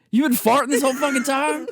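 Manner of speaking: loud, high, laugh-tinged accusation; retold outraged disbelief. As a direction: shout it in hammed-up disbelief. Retold outraged realization of prank.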